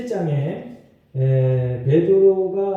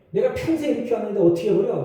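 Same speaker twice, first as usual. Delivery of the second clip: higher, quick mock protest; defiant talking back; 6 semitones higher, faster than usual. Church member refusing to drop lifelong bad habits, defiant. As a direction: fire back like a stubborn objector. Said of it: "이렇게 대들어요".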